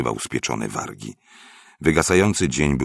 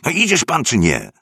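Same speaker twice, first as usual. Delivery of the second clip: high, loud impatient prodding; 12 semitones higher, 7 dB louder than usual. A narrator's reading. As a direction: demand an answer, fed up with waiting. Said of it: "Nagle zniecierpliwił się". (zniecierpliwione, głośne ponaglanie wysokim głosem)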